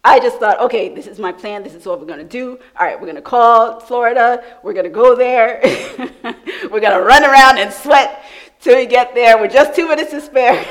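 loud, high, laughing mock plan; laughing self-mockery. Preacher mocks her own hurried plan, loud and laughing. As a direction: ham up your own rushed plan.

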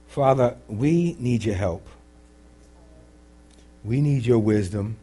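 measured, earnest congregational prayer; leading prayer. Real, not acted. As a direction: pray aloud for the room, phrase by phrase.

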